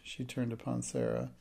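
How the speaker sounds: ordinary delivery, nothing marked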